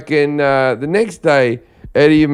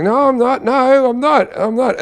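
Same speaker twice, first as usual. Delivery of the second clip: defensive denial; 9 semitones higher than usual. Retold or imitated. Denying to angry girlfriend.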